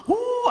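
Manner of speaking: sharp gasp into a high woo. Retold or imitated. Passenger gasps as the plane drops, high woo. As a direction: gasp like the plane just dropped. Plane drop, gasping fright.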